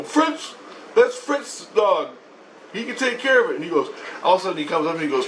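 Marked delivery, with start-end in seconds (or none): 0.0-3.6 high-pitched mock explaining; insistent wife. Christ and wife answer him, high insistence. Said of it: "Christ and my wife go".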